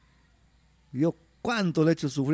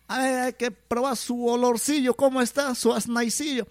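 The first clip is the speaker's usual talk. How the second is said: higher, curious voice sniffing the brew; sniffing the potion; 8 semitones higher than usual. Acted. Healer smelling the fresh potion in a high voice.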